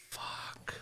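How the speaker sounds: 숨죽여 욕하는 속삭임; whispered curse. Real, not acted. Muttered curse aside.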